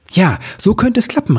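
high, hopeful exclamation; excited call. A narrator's reading. Elf hits on a plan at the window, excited call. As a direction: call out a sudden idea in a high voice. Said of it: "rief Ribbelwitsch".

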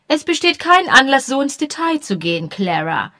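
high, loud, haughty widow scolding; imperious widow rebuking her maid; prim rebuke. Acted. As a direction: put the maid in her place.

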